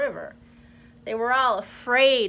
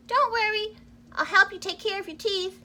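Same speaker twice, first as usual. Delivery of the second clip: much higher reassuring mouse; 9 semitones higher than usual. Acted. Mouse answering the crocodile while eating, high voice. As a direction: reassure him while nibbling. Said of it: "answered the mouse, nibbling".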